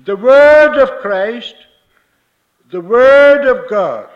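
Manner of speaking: loud, slow, emphatic proclamation; emphatic preaching. Real, not acted. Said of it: "And I want to emphasize".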